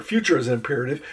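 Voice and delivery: usual speaking voice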